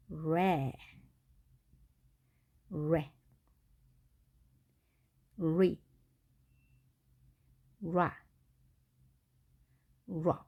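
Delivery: 레, 레, 리, 라, 러 as English re, re, ri, ra, ro, with long pauses; curled-tongue R. Shown as a demonstration. Teacher modelling R one syllable at a time, 레, 레, 리, 라, 러 with curled tongue. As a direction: say each of 레, 레, 리, 라, 러 apart, tongue off the palate. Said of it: "혀를 구부려준다고 해서요".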